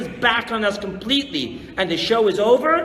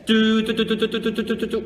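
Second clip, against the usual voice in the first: shofar imitation; 2 semitones higher, faster than usual. Mimics shofar blasts.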